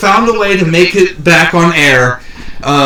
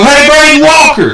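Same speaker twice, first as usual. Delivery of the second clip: high, mock fan greeting; calling out; 9 semitones higher, 3 dB louder than usual. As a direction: holler it as if hailing a wrestler. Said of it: "go up to him and go".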